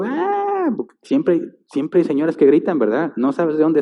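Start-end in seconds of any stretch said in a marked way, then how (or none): 0.0-0.8 high shrill imitated shriek of a woman in the crowd; a retold crowd scream, pitched very high. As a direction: let out a short, very high mock scream. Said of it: "ves así el griterío".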